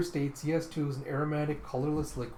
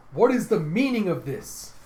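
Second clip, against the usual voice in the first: higher, loud demand; anguished; 6 semitones higher, 9 dB louder than usual. Retold or imitated. Vilma comes up from behind, anguished. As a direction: cry out the question in anguish. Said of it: "looking all anguished".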